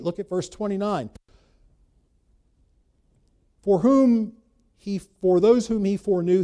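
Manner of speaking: loud, driving sermon voice; full-force preaching. Acted. Pastor urging the congregation through Romans eight, full-force preaching. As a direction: preach it hard to the pews.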